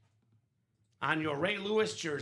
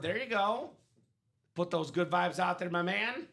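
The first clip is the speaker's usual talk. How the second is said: usual speaking voice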